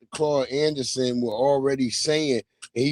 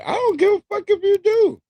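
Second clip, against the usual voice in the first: defiant outburst; 5 dB louder than usual. Real, not acted. Defiant to Tariq critics.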